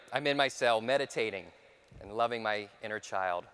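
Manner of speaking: ordinary talk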